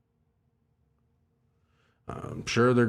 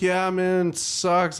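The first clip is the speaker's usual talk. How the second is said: mock reply; 9 semitones higher, slower than usual. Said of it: "And I'm like".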